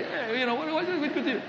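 high-pitched mumbled New York guy; New York accent. Acted. Preacher impersonating sweaty Nabal, comic New York accent. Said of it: "this guy with a New York accent".

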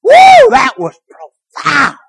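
high, loud exaggerated whoa; mock awe. Minister mocking his awed listeners: loud exaggerated awe. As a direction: ham up amazed listeners. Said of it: "they'd all go".

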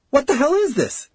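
high, shocked outcry; alarmed demand. A narrator's reading. Witness watching a man age before her, alarmed. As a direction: demand an answer in horror.

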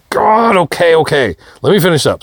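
high, harried giving-in; exasperated. Retold or imitated. Husband in the shower gives in to wife, exasperated. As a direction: cry out in frustration, then settle as you give in.

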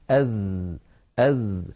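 "أذ" with the voiced th held on; soft, continuant dhal. Shown as a demonstration. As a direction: stretch the buzz at the end of "أذ". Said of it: "والذال حرف رخو".

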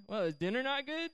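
high, rising follow-up question; probing question. Retold or imitated. Retold questioning of the date.

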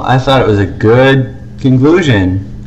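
normal talking voice

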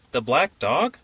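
ordinary delivery, nothing marked

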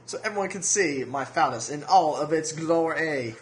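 slow, lightly voiced Southern drawl; a hint of a pompous Southern plantation owner, slowed down; mock Southern gentleman accent, hammed-up. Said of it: "really horrible Southern plantation owner accent".

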